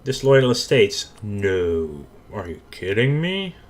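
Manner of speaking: ordinary talk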